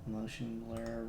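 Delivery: ordinary talk, nothing marked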